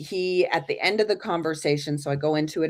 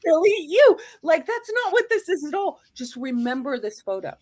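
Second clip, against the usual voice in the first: much higher, put-on voice of a flustered old man; exasperated grandfather; 14 semitones higher, 5 dB louder than usual. Grandfather correcting his granddaughter about the photo, high flustered voice. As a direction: playfully protest as the grandfather.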